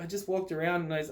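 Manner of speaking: ordinary talk, nothing marked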